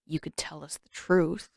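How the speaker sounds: low, soft grumble under the breath; muttered. Acted. Sokka grumbling at his sister, muttered low and soft. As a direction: mutter it half to yourself. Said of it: "Sokka muttered".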